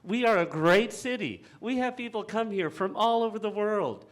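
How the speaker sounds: proud and fired up about the city; emotional. Real, not acted. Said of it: "I'm getting a little emotional".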